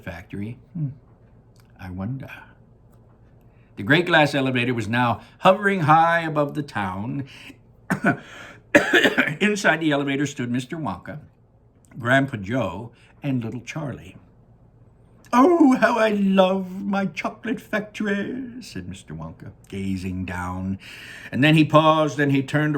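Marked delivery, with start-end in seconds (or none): 3.8-14.2 lively read-aloud narration; story narration. A narrator's reading. Teacher reading the class chapter, lively storytelling. 15.3-18.6 put-on high, slow, delighted old showman; Willy Wonka voice. Wonka gazing down at his factory, high and delighted.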